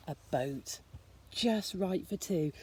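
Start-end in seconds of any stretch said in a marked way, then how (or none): none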